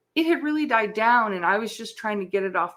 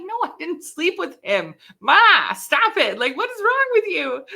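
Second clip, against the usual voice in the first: put-on high, loud teen outrage; mock indignant daughter; exasperated protest; 9 semitones higher, 6 dB louder than usual. Daughter yelling at her mom, high and exasperated.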